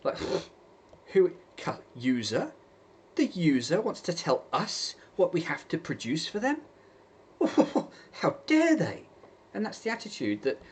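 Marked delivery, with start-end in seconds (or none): 1.1-9.1 mocking a haughty company voice, spiking high at the end; arrogant. Software company scoffing at users, arrogant mock outrage. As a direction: sneer it out like an offended corporation, then squeal the last words. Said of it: "Now they're just, like, arrogant".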